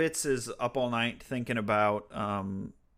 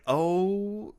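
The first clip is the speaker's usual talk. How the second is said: dismayed surprise; 8 semitones higher, 4 dB louder than usual. Retold or imitated. Fitz realizes she's the princess.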